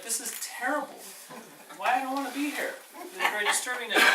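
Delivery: mock-horrified young prince; dismayed prince. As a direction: play the sheltered prince, fussily upset. Prince dismayed, voiced high.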